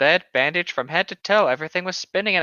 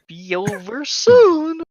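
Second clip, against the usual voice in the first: hammed-up villain; 9 semitones higher, 6 dB louder, much slower than usual.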